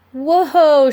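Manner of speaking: loud high whoa as she's lifted; startled cry. A narrator's reading. Pearl lifted into the air, startled loud cry. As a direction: yelp of surprise. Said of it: "she cried".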